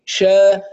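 شا with a strong, spread sh from mid-tongue; strong sheen. Shown as a demonstration. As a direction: push air wide across the tongue on شا. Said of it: "لكن صوتها قوي". (شين قوية متفشية)

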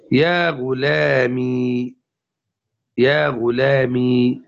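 slow, drawn-out يا غلامي ending in long "ee"; sustained silent ya. Shown as a demonstration. Teacher demonstrating the first form, slowly holding "-mee" in يا غلامي. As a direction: stretch the final ya of يا غلامي. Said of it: "بإثبات الياء الساكنة".

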